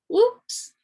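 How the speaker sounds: sudden high squeak of surprise; a startled little yelp; oops. A narrator's reading. Narrator reacting to escaping mushrooms, high surprised yelp.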